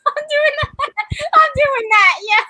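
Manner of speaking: laughing while saying it, high and loud; loud high laughing repeat. Real, not acted. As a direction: giggle through the words. Laughing playful boast.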